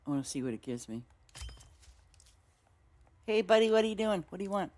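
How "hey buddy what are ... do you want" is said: eager call. Real, not acted. Greeting villager, calling out.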